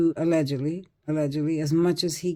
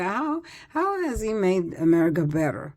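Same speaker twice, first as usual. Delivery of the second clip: ordinary talk, nothing marked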